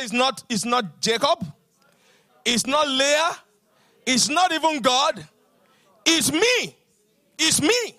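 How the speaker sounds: rising to loud, emphatic preaching; building declaration. Retold or imitated. Congregation led in building declaration.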